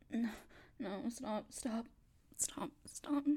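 hushed, breathy pleading stop; begging under her breath to be let go; frightened whisper. Acted. Woman begging a stranger to stop, whispered.

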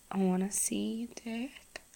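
soft intimate whisper; breathy close-mic whisper. Acted. Whispered intimate roleplay throughout.

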